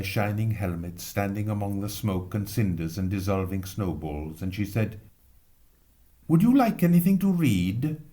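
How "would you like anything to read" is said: put-on polite old aunt. Prim aunt to firemen.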